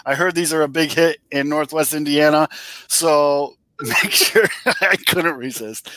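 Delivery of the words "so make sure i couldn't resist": laughing through the words; can't stop chuckling while talking. Real, not acted. Laughs while plugging shirt.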